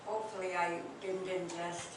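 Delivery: normal talking voice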